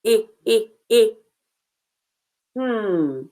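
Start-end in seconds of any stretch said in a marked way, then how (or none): 0.0-1.1 "I" as a quick short "ih", repeated; three separate short vowels, "I" as in chick; short I sound. Shown as a demonstration. Teacher isolates the short vowel.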